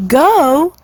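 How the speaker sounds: put-on startled high yelp of young swans; surprised cry. A narrator's reading. Cygnets crying out to their father, startled. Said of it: "cried all the cygnets".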